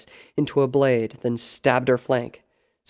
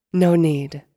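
raised, louder, airy dismissal; arch boss; 4 semitones higher, 7 dB louder than usual. A narrator's reading. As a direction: wave it off loftily.

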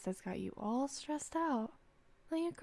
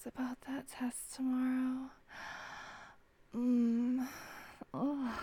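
intimate whisper. Acted. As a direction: whisper tenderly, drawing them in.

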